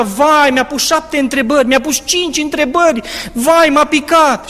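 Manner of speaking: high, mock whining students; lightly voiced panicking classmates; a hint of frightened students coming out; anxious students. Students complaining after the oral.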